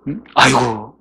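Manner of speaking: normal talking voice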